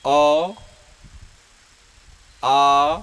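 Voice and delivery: "अ" and "आ" each said alone, loud, with a pause after; teacher dictating the vowels; dictated vowels. Shown as a demonstration. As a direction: call out "अ", "आ" one by one. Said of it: "जैसे देखिए".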